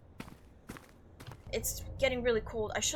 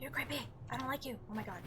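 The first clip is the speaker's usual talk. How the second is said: usual speaking voice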